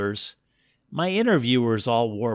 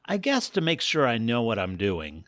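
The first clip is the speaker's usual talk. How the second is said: usual speaking voice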